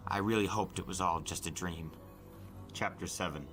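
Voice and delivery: plain reading voice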